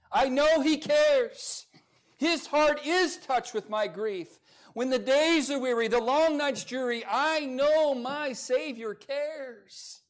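high, loud and slow affirmation; full-force preaching. Acted. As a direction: proclaim "I know he cares" with slow conviction. Preaches the hymn's chorus.

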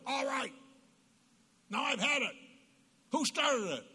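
high, loud mock dad yell; angry dad yell. Father yells at fighting kids behind the door, mock angry yell. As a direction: burst in like a fed-up father.

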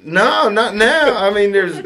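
mock pleading. Retelling his desperate refusal.

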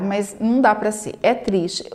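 usual speaking voice

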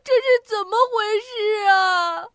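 put-on high, sobbing child wail; panicked tears; crying anxiously. A narrator's reading. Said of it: "彤彤着急地哭了起来". (着急地哭喊)

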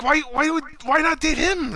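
high, baffled questioning; nosy driver. Retold or imitated.

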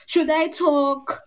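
very slow, teasing question; slow tease. Real, not acted. Fan teasing co-hosts, very slow. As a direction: stretch it out playfully.

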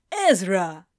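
lightly voiced deep man, loud; a hint of a deep-voiced father; firm, deep greeting. A narrator's reading. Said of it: "his voice as deep as Gabriel's".

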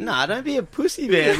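high mocking taunt. Fifteen-year-old peer pressuring his mate, high taunt. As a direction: needle him like a cocky teen.

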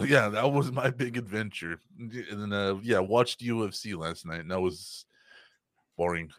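normal talking voice

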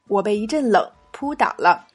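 ordinary talk, nothing marked

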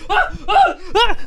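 high death cries; dying scream. Acted. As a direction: cry out like a dying extra. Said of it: "he's the guy who goes".

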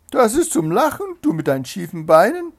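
loud, high-pitched ridicule; scoffing. A narrator's reading. Hare laughing off the race challenge, scoffing. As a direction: dismiss the challenge with loud disdain.

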